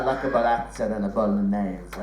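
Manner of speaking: hammed-up Italian accent on "burrata" and "bolognese"; mock Italian accent. Comic imitating mum ordering in a mock Italian accent. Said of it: "who does the accent of the food".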